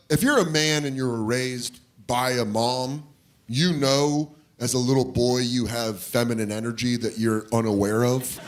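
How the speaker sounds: usual speaking voice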